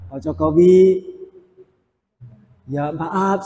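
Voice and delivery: pelan dan lembut, minta maaf dengan hormat; sopan. Retold or imitated. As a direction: bicara santun kepada presiden dengan pelan. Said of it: "ngomongnya harus sopan". (sopan, pelan dan lembut)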